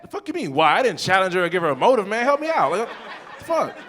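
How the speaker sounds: high-pitched exasperated protest; hammed-up incredulous outburst; mock indignation. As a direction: protest in disbelief, voice jumping high. Acted indignant reply to girlfriend.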